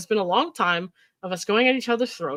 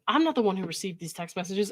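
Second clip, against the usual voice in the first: ordinary delivery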